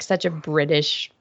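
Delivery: normal talking voice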